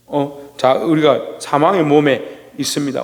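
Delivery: usual speaking voice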